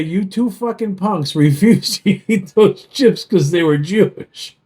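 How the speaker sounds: normal talking voice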